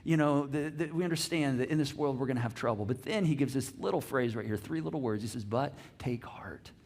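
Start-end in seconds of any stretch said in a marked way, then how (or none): 5.4-6.7 slow, soft, weighty delivery; hushed take heart. Retold or imitated. Pastor voicing Jesus to congregation, slow and hushed. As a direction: let the words land gently. Jesus' words, quietly emphasized.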